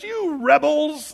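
high, loud, slow angry cry; hot-tempered Moses. Retold or imitated. Moses scolding the grumbling people, angry shout. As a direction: fling it at the crowd like an exasperated prophet. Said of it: "He's a little hot here".

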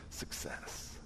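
breathy whispered victory word; whispered triumph. Retold or imitated. Man sneaking out of church whispers his victory. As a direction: whisper it like a getaway win.

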